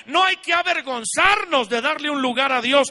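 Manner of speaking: loud, urgent exhortation; urgent shout. Real, not acted. Pastor urging the hesitant to come forward, loud exhortation. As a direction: push them past their shame.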